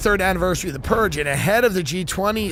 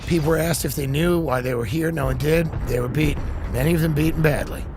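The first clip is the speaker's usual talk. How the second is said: deadpan calm press statement; calm Biden; 5 semitones lower than usual. Pretend. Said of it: "Biden says it very calmly".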